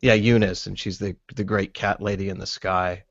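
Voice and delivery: ordinary delivery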